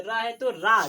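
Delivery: रा said loud with a long आ; loud powered रा; forceful long aa. Shown as a demonstration. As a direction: hit रा hard. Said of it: "जोर से रा है".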